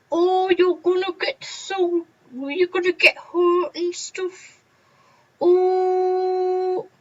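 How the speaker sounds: high, slow nagging teacher voice; mock fussy teacher. Teachers warning pupils about running, a high mocking whine. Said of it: "the teachers normally say to you".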